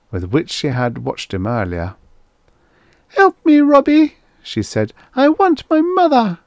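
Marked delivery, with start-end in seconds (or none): 3.2-4.2 put-on very high, loud child voice; pleading little girl. Little girl asks robot for help, pleading. 5.2-6.4 put-on very high, loud child voice; pleading little girl. Little girl asks robot for help, pleading.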